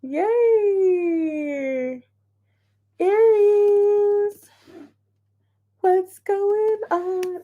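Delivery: high, slow sing-song hello; high excited sing-song; cheery greeting. Real, not acted. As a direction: greet the sign like a friend.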